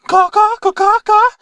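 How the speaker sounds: high squawked caws as conversation; speaking crow. Acted. Rapper answering in crow language, cawing. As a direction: talk in fluent crow squawks. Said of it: "can speak crow language".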